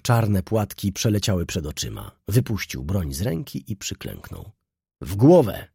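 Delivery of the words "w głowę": someone shouts. A narrator's reading. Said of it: "krzyknął ktoś".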